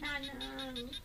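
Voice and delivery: barely audible weak call; faint weak reply. Acted. Sick daughter weakly answering her mother, faint.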